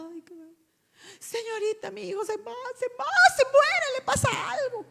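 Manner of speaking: high, frantic mock panic; a mother sure her son is dying; panicked mother. (madre desesperada en el hospital, voz aguda)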